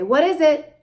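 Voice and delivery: high loud question; high parentese question; single question to child. Shown as a demonstration. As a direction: ask once clearly. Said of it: "Just say".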